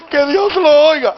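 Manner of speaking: high, loud mock weeping; imitating crying woman. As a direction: wail it like the tearful mother. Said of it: "Y ella me dijo llorando". (imitando un llanto suplicante)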